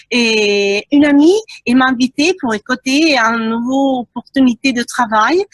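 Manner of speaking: French with an Italian accent; Italian speaker talking French. Real, not acted. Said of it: "excusez-moi mon français, je suis italienne".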